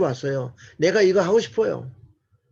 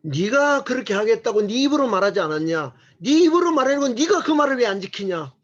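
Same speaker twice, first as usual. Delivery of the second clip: scolding; 6 semitones higher than usual. Pretend. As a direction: scold them like a parent. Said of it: "야단칠 때 이래 주세요".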